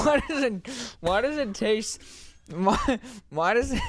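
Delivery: disgusted disbelief. Real, not acted. Reacting to the shot's taste.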